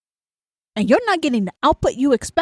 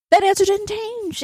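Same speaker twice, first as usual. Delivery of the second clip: high-pitched exasperated outburst; exasperated insistence; 10 semitones higher than usual. Acted. Ranter tells the pesterer the answer stands, exasperated. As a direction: pitch it way up in mock disbelief. Acted exasperation at being asked again.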